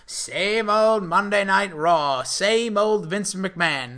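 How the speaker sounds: slow, drawn-out mock Irish accent; lightly put-on Irish lilt, slow. Co-host mocks Finn Balor's promo in Irish accent. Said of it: "That's my Irish accent".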